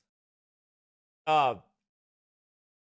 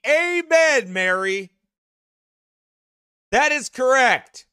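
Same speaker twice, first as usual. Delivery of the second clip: higher, louder, slow cheering; excited agreement; 5 semitones higher, 3 dB louder, slower than usual. Real, not acted. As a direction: call out agreement to Mary. Calling out agreement to Mary.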